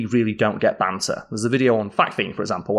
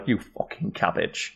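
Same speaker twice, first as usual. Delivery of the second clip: hushed mock insult; 3 semitones lower, 7 dB quieter than usual. Insulting with British slang.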